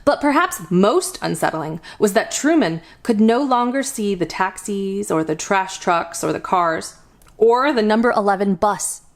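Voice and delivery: usual speaking voice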